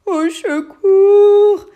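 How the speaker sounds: very slow breathy cry for help; whimpered plea. Acted. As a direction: whimper it from inside a mouth. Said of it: "gémit le lutin".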